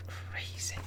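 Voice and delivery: soft whisper. Real, not acted. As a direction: breathe the word out. Whispered reaction.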